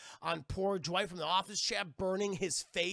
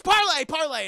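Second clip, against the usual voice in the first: much higher, much louder desperate cry; frantic shout; 11 semitones higher, 14 dB louder than usual. Retold or imitated. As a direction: scream for mercy at the door. She begs for parley.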